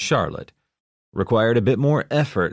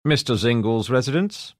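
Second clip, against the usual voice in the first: put-on English butler accent; 6 semitones higher than usual. A narrator's reading. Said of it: "The butler with the English accent".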